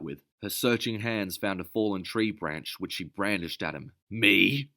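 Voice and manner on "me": loud, indignant outcry; outraged shouting retort; screamed. Acted. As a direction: shoot the word back at him. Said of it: "she screamed".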